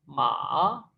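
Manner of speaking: माँ dipping low then rising; third tone, dipping. Shown as a demonstration. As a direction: dip down on माँ and come back up. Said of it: "third".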